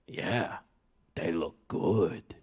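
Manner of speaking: very slow, low goblin drawl. Acted. Greedy goblin admiring the pickles, slow low drawl. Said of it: "said the goblin".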